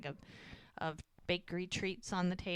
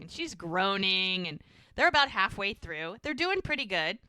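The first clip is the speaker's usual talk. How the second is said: ordinary talk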